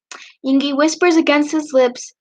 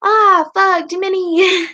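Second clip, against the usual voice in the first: louder, higher mock moan; reading a character's moan, half-acted; 5 semitones higher, 4 dB louder, slower than usual. A narrator's reading. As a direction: ham up a moaned curse. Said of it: "Yoongi moans louder".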